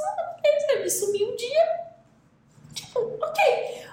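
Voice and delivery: imitating a relaxed young woman; mimicking her nonchalance. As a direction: play her carefree shrug, ending in a laugh. Mimics girlfriend shrugging off absence.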